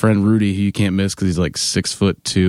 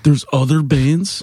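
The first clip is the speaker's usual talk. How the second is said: slow, higher, rising disbelief; flabbergasted; 6 semitones higher, slower than usual. Retold or imitated. Band member imitating a fan hearing about openers, flabbergasted. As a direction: imitate a stunned fan's incredulous question. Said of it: "He was, like, flabbergasted".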